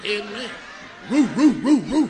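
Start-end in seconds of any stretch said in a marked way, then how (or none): 1.1-2.1 loud mock barking roo; big dog barking. Preacher boasting as the big dog, loud mock bark. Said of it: "I sound like a big dog".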